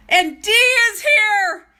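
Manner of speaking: high, loud, drawn-out squeal; real thrilled greeting. Real, not acted. Seeing a friend join the stream, real excited shout. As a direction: shout it out, overjoyed at an arrival.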